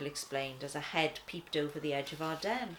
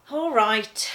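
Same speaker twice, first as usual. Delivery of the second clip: sigh; 5 semitones higher, 13 dB louder than usual. Acted. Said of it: "she sighed".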